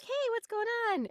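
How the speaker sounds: very high-pitched pet-talk greeting; greeting her cat. Retold or imitated. Owner checks in with her chatty cat, high pet voice. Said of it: "I'll be like".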